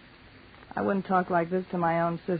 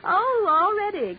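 high surprised cry on oh; sudden high-pitched oh of surprise; 13 semitones higher than usual. Acted. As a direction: caught off guard, voice leaps up. Husband arrives unexpectedly.

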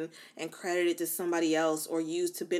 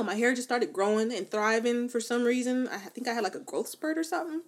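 normal talking voice

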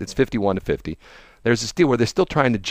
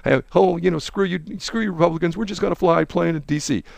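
high, gloating mock voice; sing-song sarcastic taunting; 6 semitones higher than usual. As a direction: jeer at the Republicans. Mocking Democrats taunting Republicans.

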